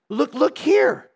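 high, slow excited plea; eager look here. Retold or imitated. Daughter eagerly showing her mother the verse, high and slow. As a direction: urge her to look, rising with joy.

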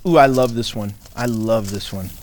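low, sarcastic relish; dry mock delight. Real, not acted. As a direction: feign fondness, sarcastically. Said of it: "That's really me being sarcastic".

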